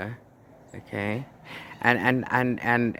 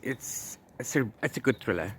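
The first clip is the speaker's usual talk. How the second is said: normal talking voice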